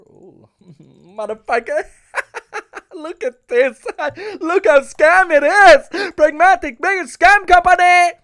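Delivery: laugh-scream in disbelief; hysterical laughing rant. Real, not acted.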